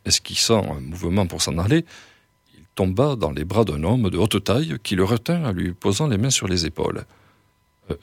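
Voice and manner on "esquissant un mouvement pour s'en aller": ordinary delivery, nothing marked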